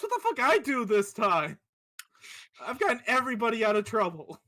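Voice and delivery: loud, high outraged yell; yelling in offended protest; indignant shout. Acted. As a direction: shout back at the accusation. Desmond indignant at being blamed.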